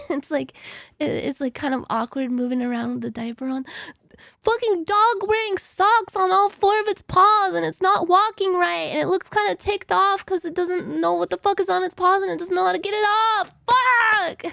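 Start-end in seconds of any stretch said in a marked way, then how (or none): 4.4-14.5 loud, raised-pitch yelling tirade; ranting shout ending in a burst; mock angry rant. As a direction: yell an absurd description as if furious. Resumes mad rant, absurd images.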